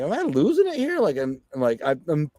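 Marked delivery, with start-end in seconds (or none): 0.0-1.0 much higher, bewildered question; incredulous self-questioning. Retold or imitated. Beat-up inmate doubting himself after feeling God speak, incredulous. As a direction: question your own sanity, pitch leaping up. Acted disbelief at hearing God.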